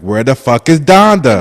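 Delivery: loud, demanding complaint; impatient yell. Retold or imitated.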